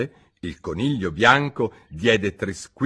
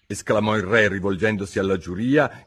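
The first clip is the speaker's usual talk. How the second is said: plain reading voice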